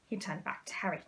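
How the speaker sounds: plain reading voice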